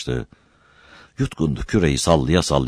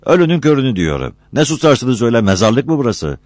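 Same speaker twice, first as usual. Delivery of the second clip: higher, louder comic indignation; exasperated retort; 8 semitones higher, 4 dB louder than usual. A narrator's reading. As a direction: snap back at the silent crew. Mustafa exasperated at silent workers. (sinirli, yüksek sesle çıkışma)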